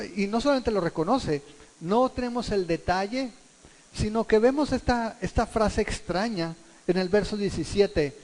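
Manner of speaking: ordinary talk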